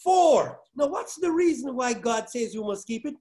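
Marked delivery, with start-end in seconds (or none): 0.0-0.6 "For." loud and higher; stressed word. Shown as a demonstration. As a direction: ring out "For." as the answer. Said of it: "God gives a reason".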